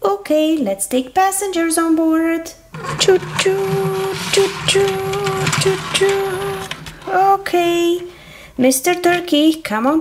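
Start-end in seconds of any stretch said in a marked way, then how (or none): 3.0-6.6 high, drawn-out choo-choo; train whistle. Pretend. Conductor playing choo-choo train for the viewers, whistle imitation. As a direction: imitate a train whistle on one held note. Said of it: "The choo-choo train is coming".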